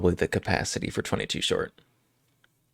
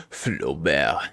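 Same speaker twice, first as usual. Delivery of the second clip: Flaubert as flo-BAIR, lower, French vowels; mock posh French Flaubert; aristocratic French accent; 5 semitones lower than usual. Shown as a demonstration. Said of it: "with the aristocratic French accent".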